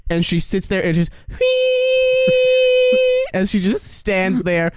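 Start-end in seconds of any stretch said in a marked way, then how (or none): 1.2-3.3 long, very high thin squeal; imitated deflating squeal. Acting out the popped pig for co-host, long high squeal. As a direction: squeal like air leaving a balloon.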